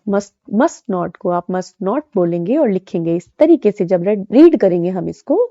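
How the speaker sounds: ordinary talk, nothing marked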